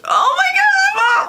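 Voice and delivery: very high, drawn-out wail; slow, high-pitched shriek; horrified outcry. Real, not acted. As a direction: cry out in real shock. Horrified reaction to the scene.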